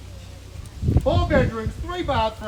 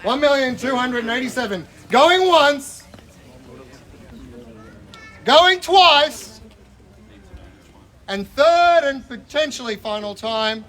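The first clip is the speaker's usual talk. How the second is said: loud, rising going once, going twice; auctioneer call; 2 semitones higher, 7 dB louder, slower than usual. Real, not acted. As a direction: call the bids out to the crowd. Auctioneer calling the bids.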